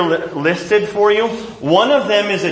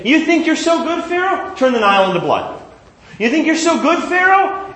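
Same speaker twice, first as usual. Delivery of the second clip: much higher, sing-song jeer at Pharaoh; mocking taunt; 10 semitones higher than usual. God mocks Pharaoh before each plague, high and sneering. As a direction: taunt him like a schoolyard bully.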